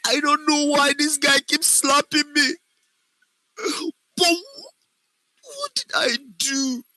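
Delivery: high, tearful wail, words broken by sobs; crying. Shown as a demonstration. Acting teacher shows a class how to use the voice, crying. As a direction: plead through tears in a high voice. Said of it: "and I'm crying".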